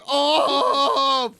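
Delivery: high strained yelling mimic; mock distressed shout. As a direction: bark it out like a panicked gamer. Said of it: "He's like".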